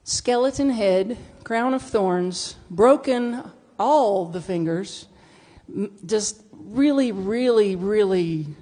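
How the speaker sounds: slow, weighty description; slow dramatic list. Acted. Describing the Buddha's makeover to the congregation, slow and dramatic. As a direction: drag out each detail for effect.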